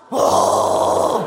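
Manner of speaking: loud breathy gasp of mock shock; a hammed-up gasp of disbelief. Mock reaction to not injecting.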